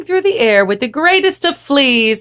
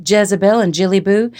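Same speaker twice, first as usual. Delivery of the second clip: plain reading voice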